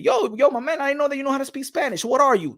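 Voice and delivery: high-pitched incredulous street acquaintance; high, curious mock bystander voice; surprised questioner. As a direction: pitch it up, puzzled and pressing. Acted surprised questioner in street dialogue.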